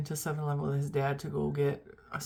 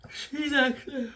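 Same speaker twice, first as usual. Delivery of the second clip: words stretched by a yawn; 9 semitones higher, 3 dB louder, slower than usual. Real, not acted. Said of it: "I apologize for yawning".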